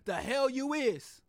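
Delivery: slow, drawn-out scornful mock retort; mock slow dismissive retort. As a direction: drag it out like a smug official. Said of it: "just build our own communities".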